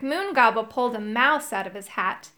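ordinary delivery

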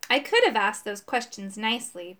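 ordinary delivery, nothing marked

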